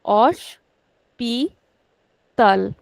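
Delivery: osh, pi, tal said apart with pauses; split into syllables. Shown as a demonstration. Teacher splitting hospital into syllables for students, slow and separate. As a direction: break hospital into its three syllables. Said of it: "We can break it into three sy- syllables".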